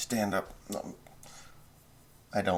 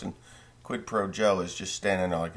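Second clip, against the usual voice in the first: ordinary talk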